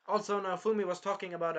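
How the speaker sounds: usual speaking voice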